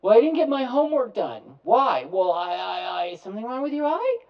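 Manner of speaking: put-on loud, high kid voice dodging; mock kid excuse. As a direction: play a kid dodging blame. Kid excuse in acted scene.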